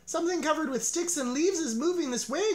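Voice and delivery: high, urgent warning cry; a squirrel cries a warning to everyone; a high alarmed call. A narrator's reading. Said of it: "cried a squirrel".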